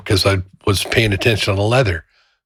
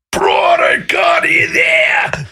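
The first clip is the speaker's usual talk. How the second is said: bellowing at the worker, slow and high; retold angry shout; 6 semitones higher, much slower than usual. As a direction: yell it at the guy who messed up. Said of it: "screaming at me".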